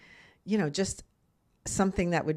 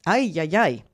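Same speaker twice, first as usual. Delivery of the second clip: exasperated ay ay ay; 6 dB louder than usual. Real, not acted. Exasperated exclamation about bad advice.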